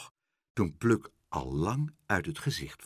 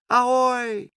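high, drawn-out sailor's call; long high halloo; distant hail; 7 dB louder than usual. A narrator's reading. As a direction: call ahoy from afar, pitched very high. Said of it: "klonk het zwakjes".

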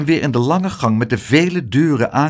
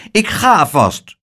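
stubborn; 5 semitones higher, 4 dB louder, slower than usual. A narrator's reading. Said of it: "zei koppig".

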